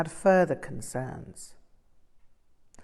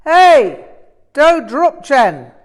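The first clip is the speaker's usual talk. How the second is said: much higher, much louder roar at the corpse; furious yell; 9 semitones higher, 16 dB louder, slower than usual. A narrator's reading. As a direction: bellow his name to wake the dead. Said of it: "he yelled".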